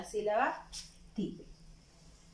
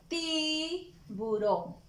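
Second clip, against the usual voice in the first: Tiburón drawn out very slowly, ti-bu-rón syllable by syllable; stretched syllables; 3 semitones higher, much slower than usual. Shown as a demonstration. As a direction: spell Tiburón out slowly for small children. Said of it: "Está iniciando con la sílaba ti".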